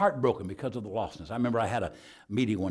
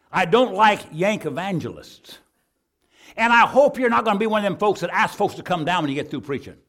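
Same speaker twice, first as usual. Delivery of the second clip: loud, high-pitched indignant retelling; indignant telling-off; 9 semitones higher, 12 dB louder than usual. Retold or imitated. Church lady warning off a visiting preacher, indignant and loud. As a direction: lay down the law, loud and high.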